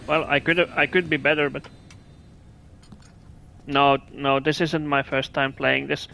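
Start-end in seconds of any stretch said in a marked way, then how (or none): none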